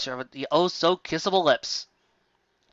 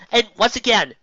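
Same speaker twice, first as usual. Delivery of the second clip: ordinary talk, nothing marked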